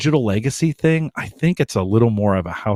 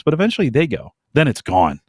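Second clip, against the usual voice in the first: normal talking voice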